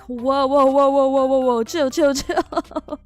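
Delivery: retelling calming down angry landlords; loud retold whoa. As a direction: push back loudly, telling them to chill. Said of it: "And I was like".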